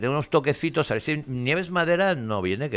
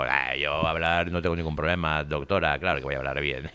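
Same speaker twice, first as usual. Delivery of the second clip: numb lip; 9 semitones lower, 5 dB quieter than usual. Real, not acted. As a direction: talk with a numb upper lip. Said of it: "estoy hablando así como un poco de abuelete".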